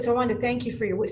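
usual speaking voice

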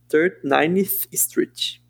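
Third, Ninth Street very slow, th with tongue out; careful English th. Shown as a demonstration. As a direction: spell out Third, Ninth Street slowly with tongue between teeth. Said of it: "Tem que fazer a linguinha".

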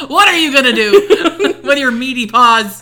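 laughing, loud mock taunt. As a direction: tease him through laughter. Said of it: "mocking his fingers".

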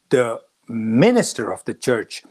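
ordinary delivery

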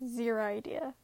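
ordinary delivery

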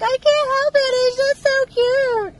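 high, loud, drawn-out protest; gushing daughter. Retold or imitated. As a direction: imitate a daughter helplessly cooing. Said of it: "she'll be like".

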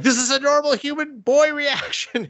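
high, hammed-up explaining voice; mock awkward hero. Hero explaining to the orphan girls, hammed-up high voice. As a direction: play the flustered guy covering it up.

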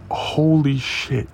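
drawn-out, high-pitched holy shit; amazed cop. Retold or imitated. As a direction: imitate an amused, astonished cop. Acts out cop busting out laughing.

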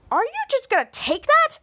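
high, swooping disbelief; incredulous goading. Retold or imitated. Mika goads Ray about the insult, incredulous. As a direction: pitch it way up as a challenge.

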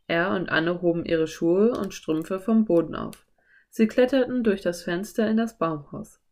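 ordinary delivery

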